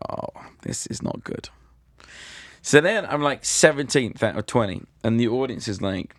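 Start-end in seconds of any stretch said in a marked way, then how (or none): 0.1-1.5 unvoiced whisper to himself. After grumpy judge walks out, dread-filled whisper. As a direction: breathe it out in dread.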